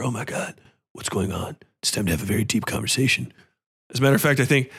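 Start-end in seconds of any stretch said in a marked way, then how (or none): 0.0-3.3 mock dramatic movie talk. Mocking movie beach-house characters.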